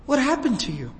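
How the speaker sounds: imitating a child's gasping disbelief; retold shocked daughter. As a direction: voice the daughter's alarm at seeing her father. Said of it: "And she gasps".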